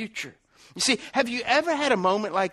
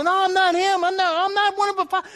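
high-pitched, acted panicky protest; frantic denial; 13 semitones higher, 5 dB louder than usual. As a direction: play Peter hastily disowning him. Peter denies knowing Jesus.

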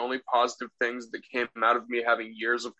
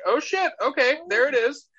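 mock surprise; 9 semitones higher than usual. Surprised by aggressive swab.

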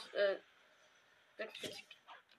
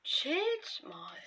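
breathy high whisper; 11 semitones higher than usual. Real, not acted. Whispered aside during fight. (geflüstert)

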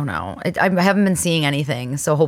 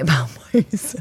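ordinary delivery

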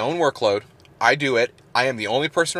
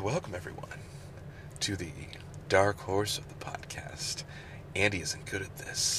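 sexy voice; 5 semitones lower, 15 dB quieter than usual. Shown as a demonstration. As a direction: whisper the title like a seduction. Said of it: "I say it in my sexy voice".

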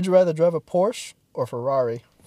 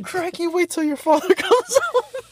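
higher, slower mock Australian dad voice; hammed-up Australian parent calling a kid; Steve Irwin Australian accent; 11 semitones higher, slower than usual. Steve Irwin imagined scolding his son, mock Australian accent. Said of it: "Call me Steve Irwin".

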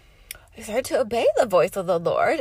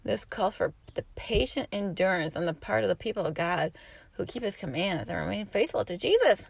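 ordinary talk, nothing marked

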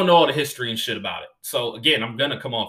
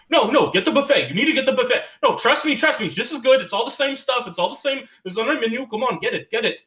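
much higher, insistent pleading voice; pushy restaurant owner; 10 semitones higher, 3 dB louder than usual. Retold or imitated. Owner keeps pressing the buffet on the comic, much higher and louder. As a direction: keep pushing, higher and higher.

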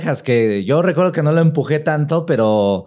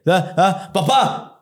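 panicked call for dad; 6 semitones higher, much slower than usual. Retold or imitated. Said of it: "se escuchaba la voz acá".

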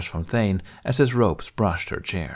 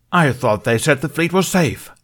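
higher, louder alarmed roar; 8 semitones higher, 6 dB louder than usual. Acted. Thothili roaring at the room about the fleet. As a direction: bellow it in alarm. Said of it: "Thothili roared".

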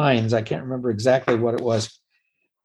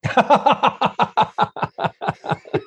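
long, high burst of real laughter; 12 semitones higher than usual. Real, not acted. Puppeteer amused by a loaded question, laughing hard. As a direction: crack up at a cheeky question.

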